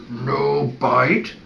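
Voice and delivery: restrained growl; growled correction. Shown as a demonstration. Vet redirecting a puppy from chewing, restrained growl. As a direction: growl it without raising your voice.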